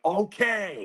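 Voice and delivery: high-pitched, drawn-out OKAY; exaggerated okay. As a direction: stretch OKAY up high in disbelief. Same reply, escalated in retelling.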